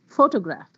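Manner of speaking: "photograph" with the first syllable, PHO, stressed. Shown as a demonstration. Teacher showing how stress clarifies words, first-syllable stress. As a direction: punch PHO at the start of "photograph". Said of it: "If the word is on the first".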